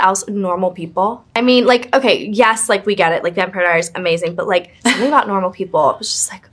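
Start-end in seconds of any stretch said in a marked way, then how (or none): 5.9-6.5 breathy whisper of awe; hushed, softer, starstruck; awed whisper. Real, not acted. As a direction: whisper it like it still moves you. Gushing about Normal People.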